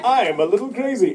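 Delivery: high, loud zany greeting; crazy Michael voice. Retold or imitated. Voicing the naked weapon-maker to the audience, high and zany. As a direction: play him as a cheerful lunatic.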